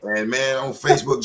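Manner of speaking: ordinary talk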